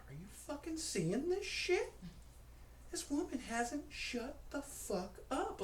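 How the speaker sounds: high-pitched disbelief without extra volume; retold high-voiced incredulity; incredulous aside. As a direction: complain to a friend in high, strained disbelief. Incredulous aside to friend, acted.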